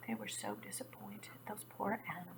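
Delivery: soft whispered pity; sad whisper. A narrator's reading. Storyteller pitying the disappointed animals in a whisper. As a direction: whisper it with sorrow.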